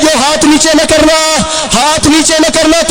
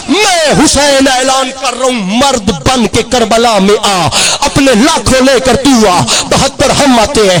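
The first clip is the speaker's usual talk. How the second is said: fiery preaching; 4 semitones lower than usual. Acted. Preacher voicing Husain's challenge to Yazid, fiery. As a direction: proclaim it like a battle cry.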